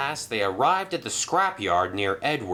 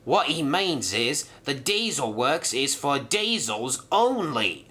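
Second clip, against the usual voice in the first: higher, altered prim explanation; lightly voiced diesel Dart; 5 semitones higher than usual. Dart telling Merlin the works is for diesels, lightly altered. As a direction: set the rules straight.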